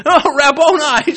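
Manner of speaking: Mary realizing the gardener is Jesus, laughing with joy; joyful recognition. Acted. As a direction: cry out in sudden delighted recognition.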